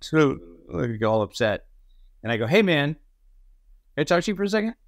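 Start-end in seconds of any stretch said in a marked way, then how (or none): 2.5-4.7 calling him over. Retold or imitated. Calling actor out after scene.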